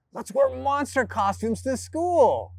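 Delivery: lightly voiced child's suggestion; child's bright idea. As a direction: propose it like an excited kid. Acting child giving good ideas.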